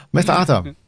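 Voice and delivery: playful teasing call; teasing sing-song. Pretend. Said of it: "Oh, no, don't".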